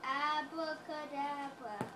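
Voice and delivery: slow drawn-out abracadabra; magic spell voice. Pretend. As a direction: cast abracadabra slowly like a stage magician. Kid casting magic spell.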